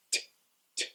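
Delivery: a crisp unvoiced t; t sound. Shown as a demonstration. Teacher demonstrating the T sound. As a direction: tap the tongue, t, t. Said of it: "your tongue is touching the top".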